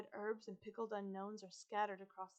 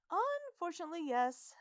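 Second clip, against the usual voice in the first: put-on higher, louder girl's voice; reluctant confirmation, pitched up; Isabella; 9 semitones higher, 7 dB louder than usual. A narrator's reading. Girl speaking through a terrier's muzzle, dismayed. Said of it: "comes Isabella's voice again".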